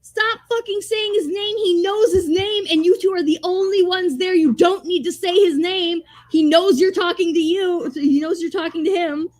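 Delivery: high-pitched, comically exasperated scolding; exasperated rant. Retold or imitated. Viewer berating boy for repeating Falcor's name, high exasperated mock rant. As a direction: scold the character in hammed-up frustration.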